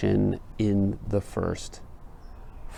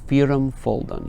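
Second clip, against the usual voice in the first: Firum punched a bit louder and higher on the first syllable; stressed reading; 5 semitones higher, 4 dB louder than usual. Shown as a demonstration. Teacher showing the stress in Firum folden, emphatic. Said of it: "you can hear the stress".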